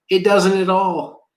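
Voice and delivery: doesn't at all, climbing high; rising insistence. Real, not acted. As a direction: push the denial harder. Insisting denial builds.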